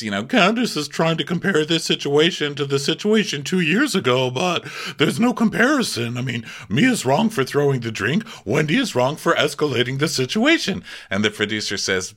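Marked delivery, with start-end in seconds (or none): 0.2-10.8 imitating Robin. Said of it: "she says".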